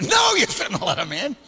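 high quick answer, half laughing; a playful retold reply; mock firm no. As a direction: toss off the obvious answer, amused.

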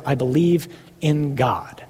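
whispered, slow and firm; whispered faith. Shown as a demonstration. Preacher declaring faith in front of hell, whispered slowly. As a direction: breathe it like a quiet vow. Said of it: "declaring faith, hushed".

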